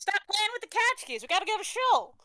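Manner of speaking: high, loud Texas Yiddish parent. Shown as a demonstration. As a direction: hurry the kids along in Texas Yiddish, pitched high. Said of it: "Texas Yiddish".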